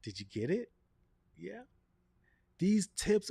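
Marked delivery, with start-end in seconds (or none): 0.0-1.6 inner voice. Retold or imitated. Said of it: "your brain to say".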